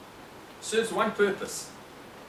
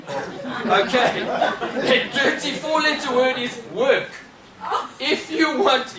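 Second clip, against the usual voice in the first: laughing; 6 semitones higher, 4 dB louder, slower than usual. Real, not acted. Laughing at his own joke.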